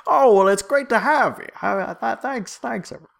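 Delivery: put-on high, loud old-time announcer voice; grand old ballroom announcer. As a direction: boom it out like an 1890s emcee. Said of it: "old-timey announcers guy".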